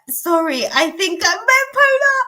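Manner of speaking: high, pleading apology; apologetic friend voice. Retold or imitated. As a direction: beg forgiveness in a high voice. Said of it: "apologizing and saying that".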